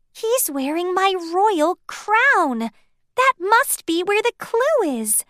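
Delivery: excited discovery. A narrator's reading. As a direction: share the discovery gladly.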